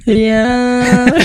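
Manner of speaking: squeaky robotic auto-tune yeah; auto-tuned voice. Real, not acted. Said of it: "Now it's auto-tune".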